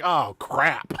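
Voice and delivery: playfully retold letdown; mock dismay. Imitating her finding soap pumps on the shower wall, mock dismay.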